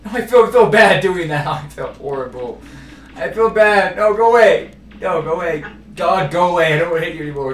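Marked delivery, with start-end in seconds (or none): 0.1-1.5 guilty outcry. Real, not acted. 3.1-7.5 shouting at the dog. Real, not acted.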